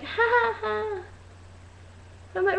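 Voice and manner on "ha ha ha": high mock laugh, fading softer; fake laugh. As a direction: imitate someone laughing at the wrong moment. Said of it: "they'll be like".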